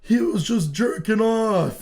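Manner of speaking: high, loud drawn-out mock yell; tattling brother impression. Brother running downstairs to tell Mom, gleeful mock shout. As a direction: holler it like a sibling telling on someone. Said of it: "tells my mom".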